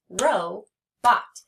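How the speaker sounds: "robot" in two loud, separated beats; teacher counting out "robot"; split into syllables. Shown as a demonstration. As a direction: leave a clear gap between ro and bot in "robot". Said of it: "two syllables".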